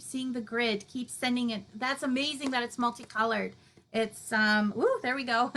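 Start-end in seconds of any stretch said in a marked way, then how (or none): 4.8-5.6 giggling through the words; laughing. Real, not acted. Host laughing as comments pour in, giggly. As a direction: bubble over with laughter.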